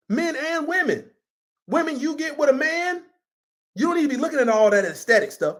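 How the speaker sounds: ordinary talk, nothing marked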